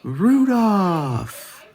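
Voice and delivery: high, delighted cry of a name; a young hare joyfully spotting his friend; excited exclamation. A narrator's reading. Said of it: "exclaimed Hoppy".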